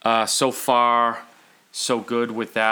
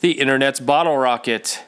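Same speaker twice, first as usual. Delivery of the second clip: podcast announcer; 8 semitones higher, 6 dB louder than usual. Acted.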